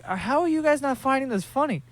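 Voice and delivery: high, far-off disbelieving voice; incredulous. Retold or imitated. To staring passengers, mock disbelief. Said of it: "I'm looking back at them like".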